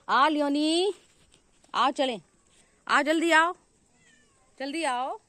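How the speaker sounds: loud, high summons; calling out. Real, not acted. Owner calls pet Lioni to come along, calling out loudly. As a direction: call the pet to come quickly.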